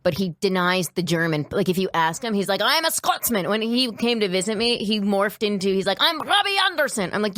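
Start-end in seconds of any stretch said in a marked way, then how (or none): 2.6-3.5 proud Scotsman put-on, pitched up; higher, hammed-up Scottish accent; mock Scottish accent. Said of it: "you don't talk like that". 6.0-7.1 high, proudly hammed-up Scottish introduction; put-on Scottish brogue, pitched up; mock Scottish accent. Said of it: "you don't talk like that".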